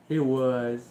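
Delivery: usual speaking voice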